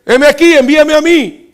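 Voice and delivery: loud, high, eager answer; eager volunteer. Acted. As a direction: jump at the call.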